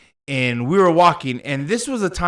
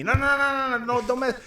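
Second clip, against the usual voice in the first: higher, pleading "no no no"; pulling him away, a touch softer; girlfriend pleading; 8 semitones higher, 4 dB quieter than usual. Retold or imitated. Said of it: "My girlfriend's tugging me".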